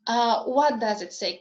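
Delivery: ordinary talk, nothing marked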